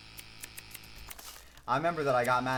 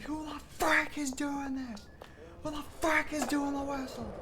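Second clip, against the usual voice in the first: mad coaches; 13 semitones higher than usual. Retold or imitated. Coaches demanding to know who is whistling, mock angry. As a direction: imitate furious coaches, pitched way up without extra volume. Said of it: "coaches would get so mad".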